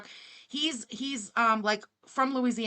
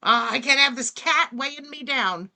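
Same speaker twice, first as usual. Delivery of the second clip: mock boyfriend; 3 semitones higher, 8 dB louder than usual. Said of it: "He's like".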